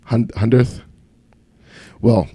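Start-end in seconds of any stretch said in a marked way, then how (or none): 0.0-0.8 "hundredth" terbata-bata, th masih tak jelas. Shown as a demonstration. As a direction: coba ucapkan "hundredth" lagi, ragu-ragu. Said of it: "I can't say my T H's still".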